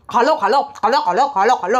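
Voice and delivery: low, repetitive urging; mimicked nagging man. Vlogger mimicking irritating man urging others to eat, mock nagging.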